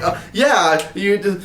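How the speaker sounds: mock fumbling for an explanation; flustered. Trying to explain himself to classmates, flustered. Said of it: "I'm just all flustered".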